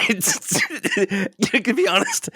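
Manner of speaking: high giggling talk; words squeezed out through giggles; laughing through words. Real, not acted. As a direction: laugh while trying to speak.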